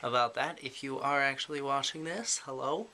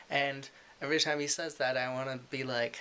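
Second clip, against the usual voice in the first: ordinary talk, nothing marked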